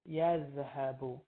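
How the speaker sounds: yazhabu drawn out slowly, ya-zha-bu; careful, stretched Arabic verb; slow model pronunciation. Shown as a demonstration. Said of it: "default case ... would be yazhabu".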